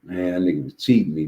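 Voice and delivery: usual speaking voice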